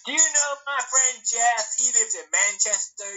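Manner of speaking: high, eager American tourist impression; mock American tourist. Imitating a tourist asking after a friend, mock American accent. Acts tourist meeting a Brit.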